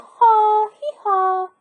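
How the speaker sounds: high hee-haw bray; donkey bray. A narrator's reading. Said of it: "Donkey bawled".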